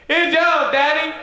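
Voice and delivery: put-on very high and loud, a kid shouting to dad; excited kid. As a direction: squeal it like a proud little kid. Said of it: "you say".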